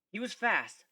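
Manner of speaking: usual speaking voice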